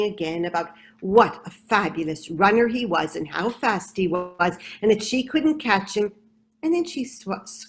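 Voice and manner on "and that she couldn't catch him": plain reading voice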